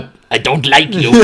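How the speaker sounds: put-on gatekeeper grumble; lightly voiced gatekeeper disdain. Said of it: "He was always, he's like".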